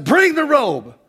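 very high, loud command; exultant father calling to servants; father's command. Acted. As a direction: order the robe brought at once. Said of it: "the father sent and said".

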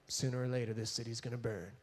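low, hushed mock menace; imitated sinister muttering; quiet gloating. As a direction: murmur it low to yourself, savoring it. Jonah gloating as he waits.

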